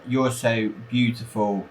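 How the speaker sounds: ordinary talk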